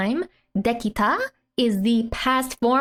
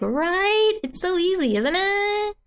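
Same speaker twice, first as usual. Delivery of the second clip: put-on playful girl voice; 9 semitones higher than usual. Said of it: "So it's like".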